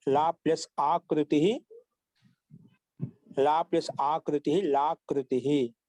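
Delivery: slow, drawn-out syllables for note-taking; slow dictation. Shown as a demonstration. Teacher dictating a sandhi example to the class, slow dictation. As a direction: dictate it slowly, stretching the endings.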